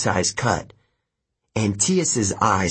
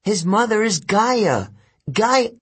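high, urgent shouted warning; yelled warning; 12 semitones higher, 3 dB louder than usual. A narrator's reading. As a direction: shout the warning before being gagged. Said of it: "Annabeth yelled at me".

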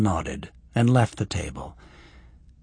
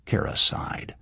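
ordinary delivery, nothing marked

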